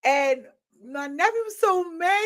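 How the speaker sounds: normal talking voice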